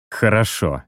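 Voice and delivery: grudging reply forced through clenched teeth; through gritted teeth. Acted. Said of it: "сквозь зубы проговорил".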